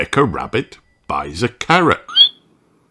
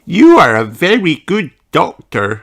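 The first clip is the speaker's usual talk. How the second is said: put-on sheep girl voice; 8 semitones higher, 5 dB louder than usual. Susie voiced.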